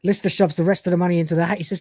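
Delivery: ordinary delivery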